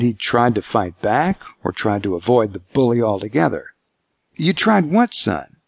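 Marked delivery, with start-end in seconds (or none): 4.4-5.6 cautious father feeling his way. A narrator's reading. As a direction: probe gently, softening at the end. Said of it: "he asked cautiously".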